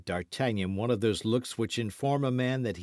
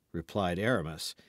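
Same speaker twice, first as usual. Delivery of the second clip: ordinary delivery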